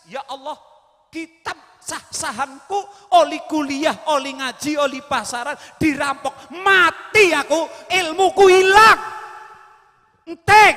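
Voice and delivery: high, loud wailing cry over lost books; crying lament. Acted. Ghazali wails to God over the stolen books, loud. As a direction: wail as if everything is gone. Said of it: "Dia nangis".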